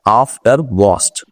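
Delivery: aftermost drawn out very slowly in English; correct English pronunciation. Shown as a demonstration. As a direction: stretch aftermost out to teach it. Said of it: "क्या कहते हैं".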